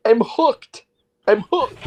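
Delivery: high, slow whimpering dog voice; pitiful pleading pup, high and drawn out. Pretend. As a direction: beg like a trapped little dog. Acting as a stuck dog in debate.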